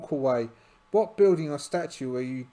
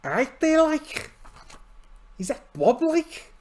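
put-on Liverpudlian accent; 13 semitones higher, 5 dB louder than usual. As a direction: ham up a Liverpool accent with lots of like. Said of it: "I'll try Liverpudlian".

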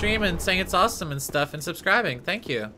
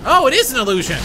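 high, loud eager realization; excited discovery; 9 semitones higher, 9 dB louder, faster than usual. Real, not acted. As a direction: burst out in delight. Discovery in game.

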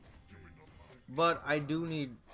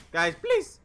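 louder desperate plea; being chased; 3 semitones higher, 9 dB louder than usual. Real, not acted. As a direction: beg loudly, under attack. Begging teammates, said twice.

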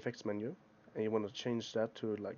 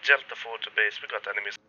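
grainy radio; 9 dB louder than usual. Shown as a demonstration. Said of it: "kind of a grainy effect".

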